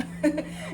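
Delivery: high burst of laughter; amused laugh. Real, not acted. Storyteller finding it funny now, laughing. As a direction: crack up at your own re-enactment. Laughs at her own retelling.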